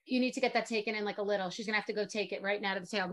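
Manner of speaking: normal talking voice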